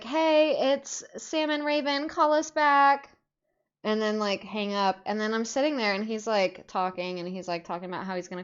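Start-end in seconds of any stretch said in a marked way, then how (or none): 0.0-3.1 higher, louder, drawn-out last words; peppy voicemail message. Retold or imitated. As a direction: chirp it into a voicemail, imitating yourselves. Reenacting their voicemail to a streamer.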